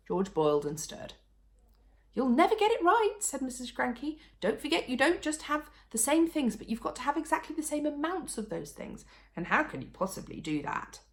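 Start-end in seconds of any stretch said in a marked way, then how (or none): 2.1-11.0 lightly voiced higher doubting wife; a hint of a nagging wife; sceptical Missus Cranky. A narrator's reading. Missus Cranky doubting the medicine, lightly voiced scepticism.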